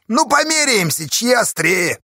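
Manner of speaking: high, loud dare; jeering, high-pitched provocation; gleeful challenge. A narrator's reading. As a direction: dare him merrily at full voice. Villain gleefully challenges rival to fight.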